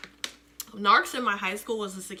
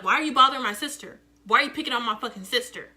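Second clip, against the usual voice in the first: louder, fast angry challenge; big sister confronting a bully, louder and fast; angry confrontation; 5 dB louder, faster than usual. Retold or imitated. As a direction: get in her face for picking on your sister.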